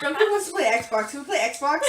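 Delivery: put-on pleading kid, laughing; whiny kid voice. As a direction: beg like a little kid. Kid asking to play Xbox.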